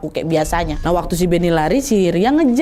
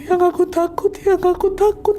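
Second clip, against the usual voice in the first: high, tearful repeated fear; crying wife; 11 semitones higher than usual. Acted. Wife clinging to husband, crying that she was scared. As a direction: whimper it out through tears, pitched up. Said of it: "tenangis-nangis Ria di situ".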